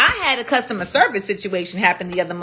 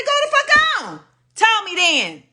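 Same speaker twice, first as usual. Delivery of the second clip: very high, defiant challenge; defiant retort; 13 semitones higher than usual. Retold or imitated. Daring the parking-lot man, high defiant challenge. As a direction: dare him, pitched way up.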